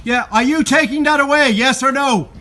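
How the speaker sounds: high, sharp demanding shout; loud sharp voice. Real, not acted. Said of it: "Stop shouting at me".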